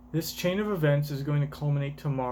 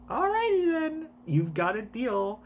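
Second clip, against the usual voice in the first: high character voice; 8 semitones higher than usual. Acted. Voicing a manga character.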